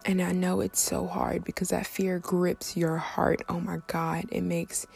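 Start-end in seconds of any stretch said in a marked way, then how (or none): none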